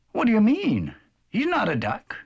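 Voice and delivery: mildly indignant little bird; a touch of an indignant robin. Acted. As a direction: stick up for the chick as a robin. Robin defends Peep.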